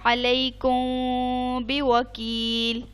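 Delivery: अलैकुम बिवकील recited slowly, the मीम held with a nasal hum; slow Quranic recitation of अलैकुम बिवकील, ghunna on the मीम; recited example. Shown as a demonstration. Teacher reciting अलैकुम बिवकील for her student, slow nasal ghunna. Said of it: "अब जैसे देखें, मिसाल है".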